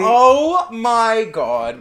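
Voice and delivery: high, loud, very slow drawn-out oh my God; thrilled gasp. Real, not acted. Hearing the drag queens named, high drawn-out thrill. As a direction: stretch it out in delight.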